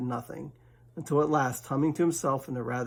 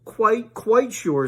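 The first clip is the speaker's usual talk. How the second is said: high, slow, louder bear; Pooh's voice; 9 semitones higher, 7 dB louder, much slower than usual. Acted. Pooh insists to Rabbit, high and slow. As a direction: insist slowly and firmly.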